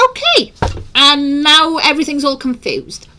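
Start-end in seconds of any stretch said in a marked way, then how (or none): 0.1-0.5 very high, quick okay; high-pitched mock agreement. Host retelling their reply to YouTube, high-pitched. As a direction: squeak out an imitated okay.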